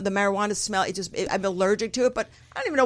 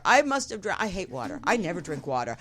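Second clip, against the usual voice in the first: usual speaking voice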